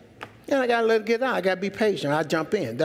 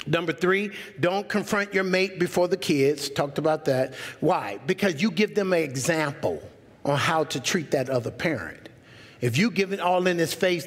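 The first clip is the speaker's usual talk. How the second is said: ordinary delivery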